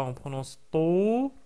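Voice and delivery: « to » allongé, voyelle tenue; étirez le « to »; to long. Shown as a demonstration. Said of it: "voyelle langue wa". (« to » long)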